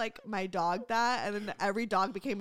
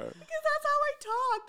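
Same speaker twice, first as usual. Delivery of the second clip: put-on baby talk. Shown as a demonstration. High baby voice.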